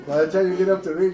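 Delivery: ordinary talk, nothing marked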